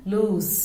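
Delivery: "lose" said with a buzzing z at the end; "lose" pronounced looz, with a z. Shown as a demonstration. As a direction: end "lose" on a voiced z sound. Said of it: "L O Z E".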